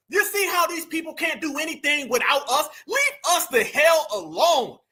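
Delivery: ordinary talk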